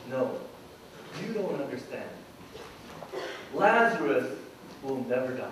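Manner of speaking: low, soft, patient correction; quiet, low-pitched assurance; calm reassurance. Retold or imitated. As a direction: gently set them straight, voice dropped. Jesus calmly corrects the disciples.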